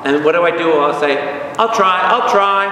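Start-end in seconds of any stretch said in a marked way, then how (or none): 1.6-2.7 eager promise. Acted. Eager pupil answering God's demand.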